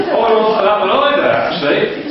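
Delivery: hammed-up woman turning down another verse; mock woman's complaint.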